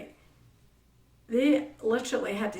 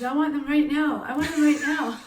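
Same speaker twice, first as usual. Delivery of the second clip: put-on demanding child; 5 semitones higher, 7 dB louder than usual. Child demanding chips, retold.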